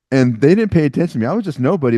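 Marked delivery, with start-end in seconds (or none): none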